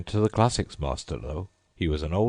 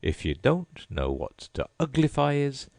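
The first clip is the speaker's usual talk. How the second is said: higher, mocking scorn; scornful; 9 semitones higher than usual. A narrator's reading. Gryphon scorning Alice, mocking and high. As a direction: sneer it at her.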